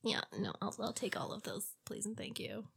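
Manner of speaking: breathy swooning whisper. Real, not acted. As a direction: whisper it, lovestruck. Swooning over villain, whispered.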